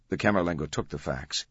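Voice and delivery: usual speaking voice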